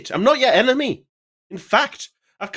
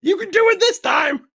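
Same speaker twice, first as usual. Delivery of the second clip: Toad voice; 4 dB louder than usual. Acted. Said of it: "You sound a little bit like, uh, Toad".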